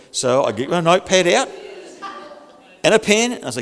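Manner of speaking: normal talking voice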